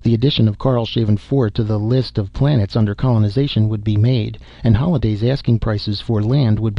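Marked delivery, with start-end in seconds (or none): none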